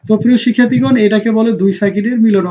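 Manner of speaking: ordinary talk, nothing marked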